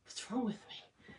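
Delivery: choked-up whisper, slow and soft; teary whisper. Real, not acted. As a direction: whisper it, fighting back tears. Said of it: "I get teary-eyed".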